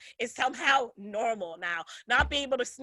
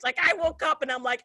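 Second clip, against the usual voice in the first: usual speaking voice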